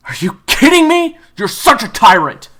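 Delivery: very high, loud outraged cry; outraged yell. Acted. Kyler yelling at June's punishment, high and outraged. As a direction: explode in disbelief.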